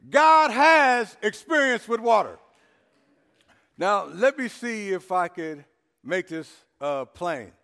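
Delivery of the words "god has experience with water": slow, loud, every word weighted; emphatic repetition. Shown as a demonstration. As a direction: proclaim it slowly to one side of the church. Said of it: "I'm gonna say it in the middle".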